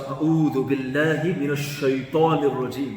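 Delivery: أعوذ بالله من الشيطان الرجيم loud and firm, each word weighted; recited with meaning. Shown as a demonstration. Teacher showing how it's supposed to be said, recited with meaning. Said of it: "That's how we're supposed to say it".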